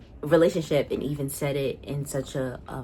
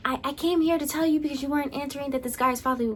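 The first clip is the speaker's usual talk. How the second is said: much higher, fast mock of her rushed excuse; mocking Sophie; 10 semitones higher, faster than usual. Imitating Sophie barging in on Margot, high fast mock. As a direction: rattle it off high like a pestering friend.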